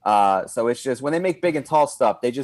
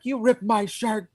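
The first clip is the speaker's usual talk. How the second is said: mock outrage; 8 semitones higher, slower than usual. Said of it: "I'm like".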